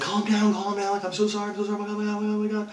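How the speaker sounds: high-pitched, flustered imitation of a woman; frantic apologising. Woman apologising to the man she wronged, frantic.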